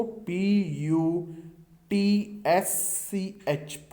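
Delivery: "पी यू" drawn out very slowly, then "टी एस सी एच"; spelling out letters. Shown as a demonstration. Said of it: "इसका सही pronunciation होता है". ("पी यू टी एस सी एच" बहुत धीरे-धीरे अक्षर-अक्षर)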